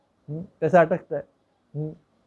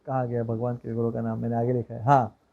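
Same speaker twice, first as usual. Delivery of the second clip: low, soft aside to oneself; muttering to self; 8 semitones lower, 6 dB quieter than usual. Real, not acted. Speaker looks for the deities' names in notes, muttering. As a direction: murmur while hunting through your notes.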